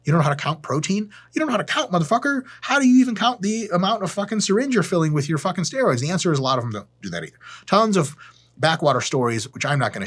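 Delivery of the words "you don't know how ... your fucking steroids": higher, building outrage; exasperated rant. Retold or imitated. Lifter berates a guy who can't count protein, exasperated rant.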